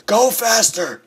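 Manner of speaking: high, loud yell to speed up; urgent shout. Real, not acted. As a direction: shout it in a rush of panic. Urging himself on while fleeing.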